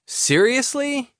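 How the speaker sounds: very high, drawn-out disbelief; incredulous. Acted. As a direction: stretch it out in disbelief. Asked to fetch diaper.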